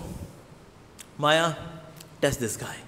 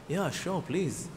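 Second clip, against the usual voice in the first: much softer, offhand yes; soft easy consent; 2 semitones lower, 10 dB quieter, much slower than usual. Acted. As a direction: grant it without a care, quietly. Shiva casually granting a boon.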